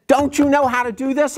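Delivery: high, exasperated demand; scolding dad. Retold or imitated. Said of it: "and I said".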